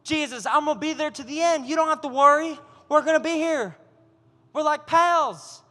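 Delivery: lightly mocking cocky reassurance; eager disciples. Disciples promising Jesus loyalty: eager, overconfident. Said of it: "They said".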